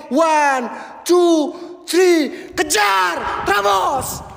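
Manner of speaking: rallying shout. Real, not acted. Rallying team to charge.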